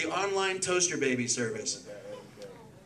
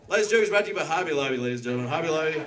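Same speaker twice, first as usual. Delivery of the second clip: ordinary talk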